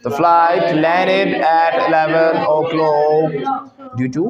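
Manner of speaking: very slow, drawn-out model line; slow model sentence. Shown as a demonstration. Teacher models the flight's landing time, slowly and firmly. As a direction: project it, stretching every word for learners.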